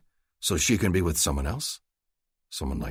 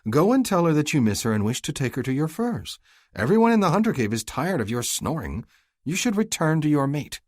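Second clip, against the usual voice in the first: higher, grinning sister's voice, bossy; teasing sister Farley; 9 semitones higher, 5 dB louder than usual. Acted. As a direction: play the cheeky younger sister nudging her brother.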